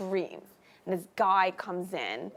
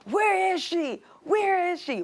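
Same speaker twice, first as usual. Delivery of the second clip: put-on frantic boyfriend; 10 semitones higher, slower than usual. Boyfriend bursting in demanding.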